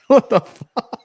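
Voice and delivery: slow words through a burst of laughter; laughing. Real, not acted. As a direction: laugh it out, incredulous.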